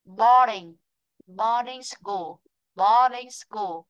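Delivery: BOARDING with the first syllable drawn out and punched; stressed first syllable. Shown as a demonstration. Teacher showing the class word stress, BOAR stressed in "Boarding". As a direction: lean long and clear on the BOAR of "Boarding". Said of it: "Chúng ta sẽ lên giọng và đọc dài hơi hơn".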